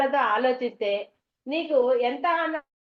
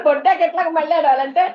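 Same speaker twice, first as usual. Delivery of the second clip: put-on louder, teasing comeback to the boy; loud retort; 3 semitones higher, 7 dB louder than usual. Speaker to crying boy, loud teasing retort. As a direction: tease the boy loudly about crying twice.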